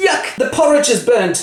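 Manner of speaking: high complaint. Acted. Girls complain at school.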